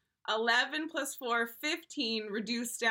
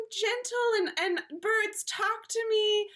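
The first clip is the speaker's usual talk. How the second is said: high, slow, sugary helpless voice; high and delicate, as if birds answer you; mock gentle sweetie; 9 semitones higher, slower than usual. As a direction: play the dainty fairy-tale maiden. Mimics gentle helpless persona.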